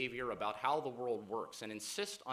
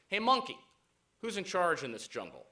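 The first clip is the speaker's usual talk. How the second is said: loud, high call on the hail, easing off; bossy lion's challenge; 6 semitones higher, 7 dB louder than usual. Acted. Lion demanding recognition from the monkey, bossy loud challenge. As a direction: call out like a self-assertive king.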